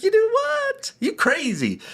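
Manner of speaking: high, squeaky incredulous shriek of a wife; shocked disbelief. Retold or imitated. Wife hearing about costly coaching, shrieking in disbelief. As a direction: mimic her voice shooting way up in shock.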